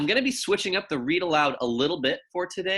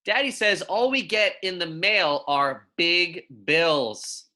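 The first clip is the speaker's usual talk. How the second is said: put-on clear, measured picture-book reading for young kids; reading to children; 3 semitones higher, slower than usual. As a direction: read it gently to second graders, savouring each pun. Reads picture book aloud.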